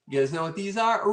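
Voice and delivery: ordinary delivery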